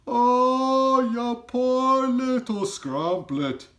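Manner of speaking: high, loud, slow pitying giant cry. A narrator's reading. Giant cries out in pity to orphan Sophie, high and slow. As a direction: wail it with big-hearted pity. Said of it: "cried the B F G".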